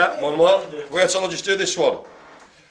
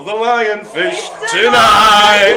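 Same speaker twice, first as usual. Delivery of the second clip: slow, louder, higher ringmaster announcing the lionfish; showman announcement; 5 semitones higher, 8 dB louder, slower than usual. Acted. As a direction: call it out like a circus ringmaster. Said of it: "I wanted to go".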